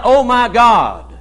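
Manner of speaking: slow, drawn-out "Oh my God", a real plea; earnest cry to God. Shown as a demonstration. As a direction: cry "Oh my God" slowly, calling on God in need. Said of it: "when you cry out".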